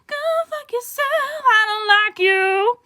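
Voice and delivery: high put-on voice, slow and petulant; squeaky mock insult. Vlogger mocking a hated book character, high and petulant. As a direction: sneer at the character in a silly squeak.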